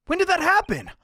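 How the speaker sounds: high, loud startled question; astonished. Acted. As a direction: burst out in disbelief. Astonished at the bolted door.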